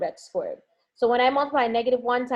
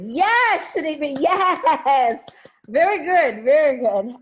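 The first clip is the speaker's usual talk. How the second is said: much higher and louder cheering; excited praise; 5 semitones higher, 5 dB louder, slower than usual. Real, not acted. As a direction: celebrate the right answer loudly. Student answers with confidence.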